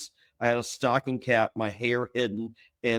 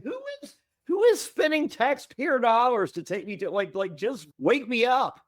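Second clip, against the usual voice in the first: baffled outrage; 9 semitones higher than usual. Retold or imitated. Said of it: "I'm like".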